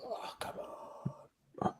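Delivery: exasperated whisper. Real, not acted.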